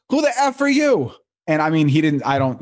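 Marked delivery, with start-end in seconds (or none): 0.1-1.1 much higher mock snap of Bill Burr; Bill Burr snapping. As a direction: blurt it out high and rude, imitating him. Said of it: "he just looks at me and he goes".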